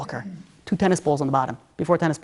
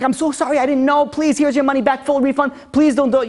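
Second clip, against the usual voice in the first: much higher begging voice; pleading owner; 7 semitones higher than usual. Retold or imitated. Store owner begging the prince, much higher and pleading. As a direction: grovel for mercy.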